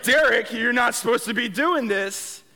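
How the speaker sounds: nagging inner voice. Retold or imitated. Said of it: "that little voice. Stronger than ever".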